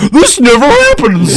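high, loud squeaky panic; mock panicked doctor. Retelling the doctor losing control, high mock panic. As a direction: play the helpless doctor. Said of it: "And he's like".

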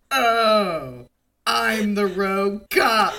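slow, loud, hammed-up dawning shock; stunned realisation, acted. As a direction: let it slowly sink in, mock stunned. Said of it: "he's stunned that he's being investigated".

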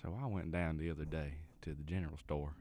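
normal talking voice

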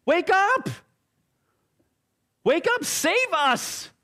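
high, slow desperate cry; panicked. Acted. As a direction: shake him awake in panic. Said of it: "probably nudges Jesus".

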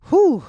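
high, loud exhaled "whew". Real, not acted. Host reacting to the show's drama, loud whew. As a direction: let out a big high-pitched whew.

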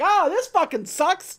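high, loud, slow groan; hammed-up complaint. The twins groan at the wrecked mountain, high and slow. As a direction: moan it like a sulking villain. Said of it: "And they're like".

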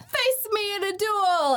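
high, hammy challenge; anime villainess. Acted. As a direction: issue it like a theatrical cartoon lady. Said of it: "this lady ... she's like".

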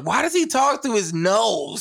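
high, drawn-out mock complaint with a laugh; retold listener complaint. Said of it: "And I'm like".

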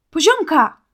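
a high, loud call of Poziomka; shout. A narrator's reading. Boy calls out to his friend as he runs to the light, loud shout. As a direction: call her name across the forest. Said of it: "krzyknął Marcin".

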